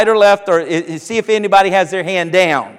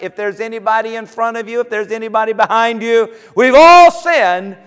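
ordinary talk

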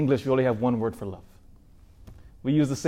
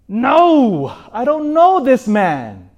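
much higher and louder, slow and drawn out; desperate denial; 9 semitones higher, 8 dB louder, much slower than usual. Acted. Peter at the fire, a desperate drawn-out denial. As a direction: cry it out in desperation.

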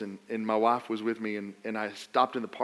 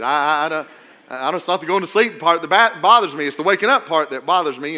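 retold loud joking protest; 2 semitones higher, 8 dB louder than usual. Joking with anesthesiologist before surgery.

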